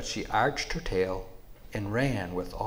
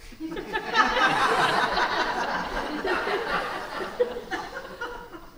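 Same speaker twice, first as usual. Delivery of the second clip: rumbling creature laugh. Acted. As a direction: laugh like a great beast. Buffalo voice laugh.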